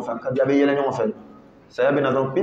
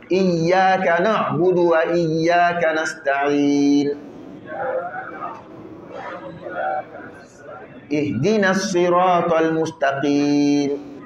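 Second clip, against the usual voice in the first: Quran recitation. Acted. Teacher recites the Fatiha for students to follow.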